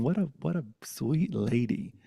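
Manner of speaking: normal talking voice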